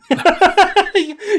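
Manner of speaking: loud squealing laugh; high burst of laughter. Real, not acted. Laughs at Michael Scott grimace.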